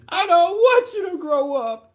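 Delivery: high, loud mock wail; hammed-up crying. Dad wailing at daughter not to grow up, mock crying.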